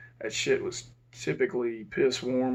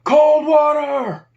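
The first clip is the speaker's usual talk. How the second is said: long drawn-out shout, much higher and louder; a guard calling cold water down the corridor, slow loud shout; yelling out; 11 dB louder, much slower than usual. Retold or imitated. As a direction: call it down the whole hallway, slow. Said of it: "he yells out down the hallway".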